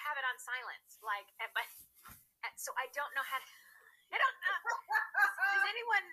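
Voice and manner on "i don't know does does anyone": high, loud laugh through the words. Real, not acted. Guest laughing at her own phone alarm. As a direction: give up on it, laughing.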